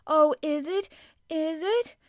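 high, loud, thrilled question; excited gasp. A narrator's reading. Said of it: "gasped Colin".

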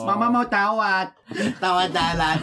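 put-on slow lisping toddler voice; cutesy baby talk. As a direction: talk like a small child, slowly. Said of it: "ngomong kayak bayi".

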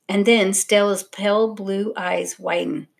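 ordinary delivery, nothing marked

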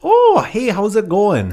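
put-on sing-song hey in a Midwestern accent; mock Minnesota-style accent. Co-host greeting Margie in a mock Midwestern accent. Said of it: "You went Australian".

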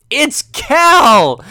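high, loud stubborn shout; mock insistence. Commentator stubbornly yelling the wrong name, loud mock. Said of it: "It's like".

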